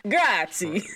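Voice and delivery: hammed-up Italian accent; bad Italian accent. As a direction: fake Italian, overdone. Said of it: "some bad Italian in there".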